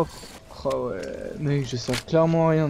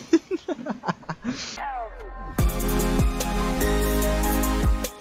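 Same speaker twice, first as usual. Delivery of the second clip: breathless, high-pitched laughter; high wheezing laugh; 11 semitones higher than usual. Real, not acted. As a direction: laugh until the voice disappears. After teammate dies.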